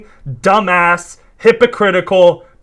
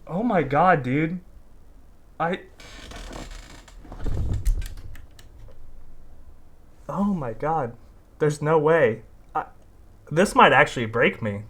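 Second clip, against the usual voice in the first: stunned; 3 semitones lower, 9 dB quieter than usual. Real, not acted. Stunned after the outburst.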